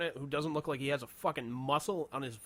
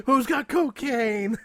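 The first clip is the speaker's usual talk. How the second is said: high, loud, drawn-out call; mock desperate shout; 11 semitones higher, 10 dB louder, much slower than usual. Bystander seeking a weapon against the alien, mock desperate shout. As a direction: holler it out like a hammed-up emergency.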